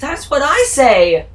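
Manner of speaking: loud, high, slow emphatic shout; loud mock-triumphant shout; loud agreeing shout. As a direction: cheer the line on.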